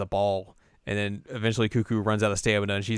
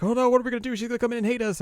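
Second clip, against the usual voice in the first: high, panicky squeal; nervous panic; 14 semitones higher, much faster than usual. Retold or imitated. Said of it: "Kanan's very nervous".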